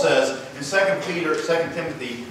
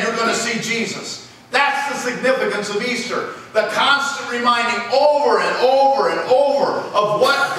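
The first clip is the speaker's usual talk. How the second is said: raised, insistent pulpit voice; forceful preaching; 9 semitones higher, 6 dB louder than usual. Acted. Preacher reminding the church of Easter's meaning, forceful preaching. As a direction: press the reminder on the congregation, high and emphatic.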